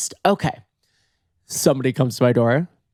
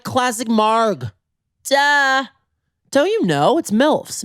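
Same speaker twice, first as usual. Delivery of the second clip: high, slow, condescending "duh"; sassy; 10 semitones higher, slower than usual. Retold or imitated. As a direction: talk down to him, pitched way up. Sassy answer to bartender.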